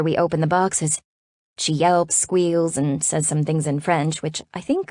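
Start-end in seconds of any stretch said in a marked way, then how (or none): none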